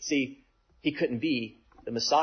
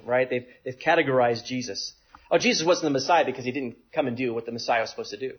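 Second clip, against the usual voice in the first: ordinary talk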